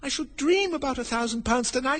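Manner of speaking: put-on high-pitched girl's voice; high voice of a fretting little girl; Alice thinking. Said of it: "thought Alice".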